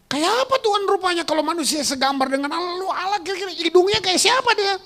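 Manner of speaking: high, loud rapid-fire rhetorical questions; preaching at full force. Real, not acted. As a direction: fire questions at the congregation, building to a shout. (khotbah berapi-api, bertanya dengan lantang)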